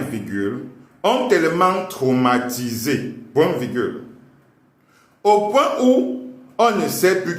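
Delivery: slow teacher dictation voice; dictating. Acted. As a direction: dictate it to the class. Dictating to class with punctuation.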